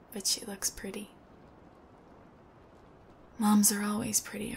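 soft, low whisper; tender. Real, not acted. Son whispering tenderly about his mother's photo. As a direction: whisper it fondly.

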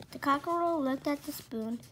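ordinary delivery, nothing marked

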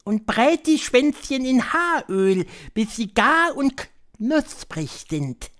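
high, slow, drawn-out witch voice; high-pitched, deliberate witch lecturer; Grand High Witch. A narrator's reading. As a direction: spell out the recipe slowly in a high witch voice.

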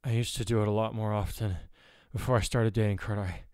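ordinary delivery, nothing marked

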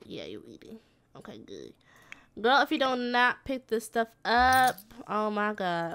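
ordinary talk, nothing marked

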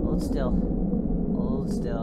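low quiet tense murmur. Real, not acted. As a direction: hold your breath and murmur.

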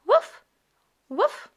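high, loud puppy woof; puppy bark. Acted. Voicing the puppy Biscuit barking.

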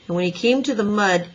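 ordinary delivery, nothing marked

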